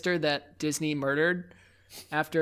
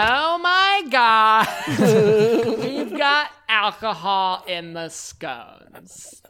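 put-on gushing Valley Girl tween; 4 semitones higher, 6 dB louder, much slower than usual. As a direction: squeal it like a tween. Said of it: "I was thinking more tween at that point".

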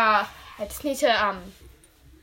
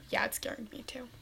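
whisper; 13 dB quieter, much faster than usual. Acted. Scared aside whispered.

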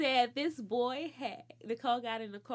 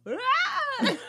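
put-on high loud yelp of a kid being hit; child's yelp; 12 semitones higher, 5 dB louder than usual. Retelling a boy yelping as mom hits him, high and loud. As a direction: cry out high like a spanked kid.

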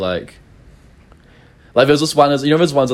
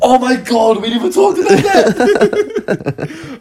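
excited laughing disbelief; 13 semitones higher, much slower than usual. Real, not acted.

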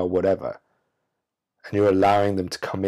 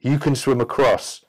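begging scorpion; 3 semitones higher than usual. Acted. Scorpion begging the frog to carry it across, pleading. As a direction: wheedle like a desperate scorpion. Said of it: "turns around to the frog and says".

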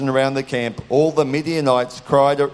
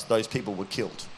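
dropped right down, softer, grave; hushed solemn; 6 semitones lower, 8 dB quieter than usual. Real, not acted. Preacher telling the congregation of the near miss, hushed and grave. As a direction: let the tragedy sink in quietly. Solemn low ending of story.

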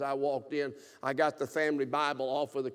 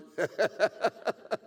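amused laugh; 6 semitones higher, 3 dB louder than usual. Real, not acted. Laughing at irony.

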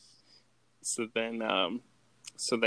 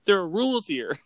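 higher-pitched retold indignation; mock pissed-off; 9 semitones higher, 5 dB louder than usual. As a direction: imitate someone laying down the law, annoyed. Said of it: "and Peter's pissed".

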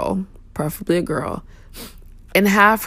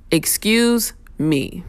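"Excuse me" drawn out slowly, polite rise; 2 semitones higher, much slower than usual. Shown as a demonstration. Showing riders how to ask politely to pass. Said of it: "two words can get you far in life".

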